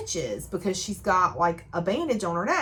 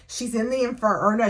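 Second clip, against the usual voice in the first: usual speaking voice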